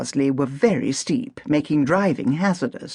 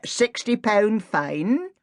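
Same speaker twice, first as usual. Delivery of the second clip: stolid policeman; 6 semitones higher, slower than usual. Acted. Policeman answers flatly.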